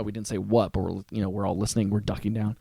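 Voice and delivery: usual speaking voice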